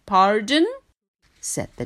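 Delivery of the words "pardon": high, puzzled giraffe asking back; a polite giraffe who didn't hear. Acted. Giraffe voiced in picture book.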